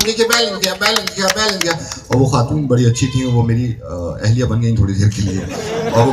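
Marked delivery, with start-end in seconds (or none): 0.0-0.7 fretful caller. Retold or imitated. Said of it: "महजबी बन के phone किया". 0.7-1.7 fretful caller. Retold or imitated. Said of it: "महजबी बन के phone किया".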